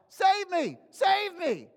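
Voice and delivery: high, urgent mock cry for help. Preacher quotes a cry to his family, crying out. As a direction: call out for rescue, pitched well up. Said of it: "would somebody cry out".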